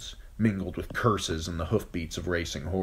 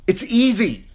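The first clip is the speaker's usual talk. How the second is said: put-on high girl's voice; 10 dB louder than usual. Arya lying to reassure.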